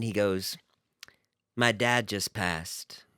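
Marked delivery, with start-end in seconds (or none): 1.6-3.0 low, very slow, softer retelling; hushed, understated news of a death; imitated somber announcement. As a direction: drop it slowly and solemnly, imitating him. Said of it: "Like that".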